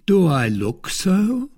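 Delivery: a smug, teasing brother-in-law; Lord de Winter. Acted. Baron's reply.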